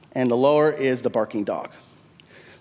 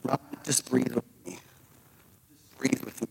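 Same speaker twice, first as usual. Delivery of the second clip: calming tone; 2 semitones lower, 11 dB quieter than usual. Retold or imitated. Helper in the hallway calming a panicking boy, soft and soothing. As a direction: coax him gently back to calm. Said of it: "In a calming tone".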